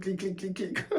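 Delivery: soft, high clicking noises mimicking the infected; imitated clicker clicks. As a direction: quietly click the tongue like a creature stalking. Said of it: "you just hear".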